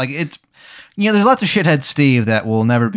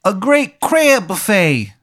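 bad Maryland accent; 10 semitones higher, 3 dB louder than usual. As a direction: ham up a terrible Baltimore accent. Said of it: "His accent is so bad".

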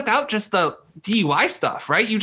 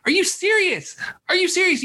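high, stunned Are you serious, said twice; disbelieving cry pitched way up; incredulous disbelief; 12 semitones higher than usual. Real, not acted. Incredulous rant about a hire.

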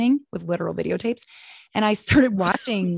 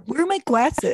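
higher, mock-exasperated demand; accusing demand; 5 semitones higher than usual. Son hunting for his glasses, mock accusing demand. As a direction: imitate a teen blaming everyone else. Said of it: "You make everyone around you feel stupid".

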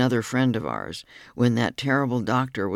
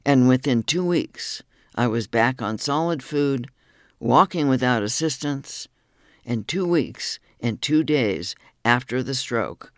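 usual speaking voice

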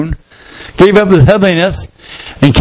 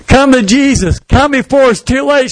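higher, urgent plea; a high, urgent gospel plea; pleading; 7 semitones higher than usual. Acted. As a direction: beg them to come before it's too late. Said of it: "She starts pleading".

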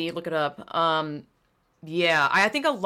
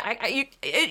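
ordinary talk

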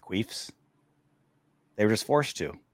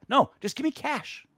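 insisting; 13 semitones higher than usual. Retold or imitated. Insisting on cash over card.

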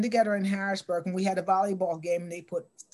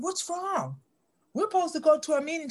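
higher, quick, worried asking; alarmed question; 9 semitones higher than usual. Retold or imitated. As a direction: rush it out, startled. Alarmed asking Nora what's wrong.

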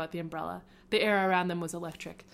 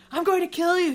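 higher, louder mock threat; playful loud kill threat; sibling threat; 10 semitones higher, 9 dB louder than usual. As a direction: yell it at your little brother. Sister chases brother, threatening.

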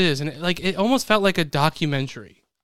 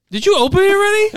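high, loud accusing question; shocked outcry; 8 dB louder than usual. Real, not acted. Host catches Jimmy opening the envelope early, shocked outcry. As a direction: burst out in disbelief, much higher and louder.

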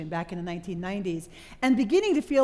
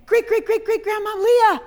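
high, loud, jubilant call through a door; 11 semitones higher, 12 dB louder than usual. Acted. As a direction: holler the good news at great volume. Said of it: "knocking on her door and saying".

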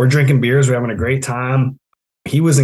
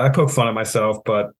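usual speaking voice

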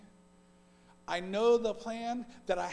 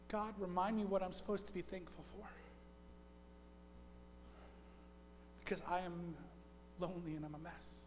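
much softer, lower, trailing away; hushed plea to God, worn out; quiet lonely prayer; 4 semitones lower, 13 dB quieter than usual. Retold or imitated. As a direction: murmur it like a tired prayer in bed. Young man praying alone at night.

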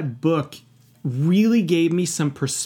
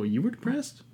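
high incredulous question, much softer; mock disbelief; 7 semitones higher, 7 dB quieter than usual. Mimicking people hearing of his depression, high and incredulous. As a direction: imitate people's surprised rise. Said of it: "most people go".

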